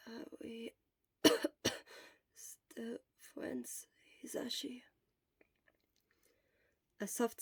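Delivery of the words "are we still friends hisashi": hoarse sick whisper, barely any voice; Shota's voice. Acted. Shota whispering weakly to his friend from the sickbed. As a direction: whisper it like a sick man worn out.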